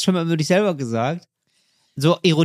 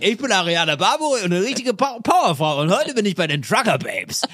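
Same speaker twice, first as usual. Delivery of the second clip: TV show intro voice; 3 semitones higher than usual. Pretend. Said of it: "bei dieser Sendung".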